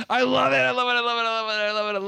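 high burst tumbling down in pitch; gushing. Real, not acted. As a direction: gush it over and over in one breathless run. Gushing repeatedly over favourite scene.

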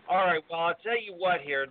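ordinary talk, nothing marked

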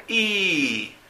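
"I" as a long held ee, high then falling; long vowel demo. Shown as a demonstration. Teacher repeats "I" to show its length, long vowel demo. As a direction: stretch "I" out as a sustained ee. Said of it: "¿Ven cómo es largo?".

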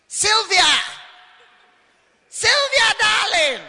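high, loud call of the wife's name; husband calling out. Retold or imitated. Married reverend calling his wife Sylvia, loud call. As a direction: call her from across the house. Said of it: "he'll be calling you".

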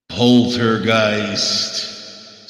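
Poltergeist stretched very slow and eerie; spooky drawn-out title. Shown as a demonstration. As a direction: draw out Poltergeist like a horror trailer.

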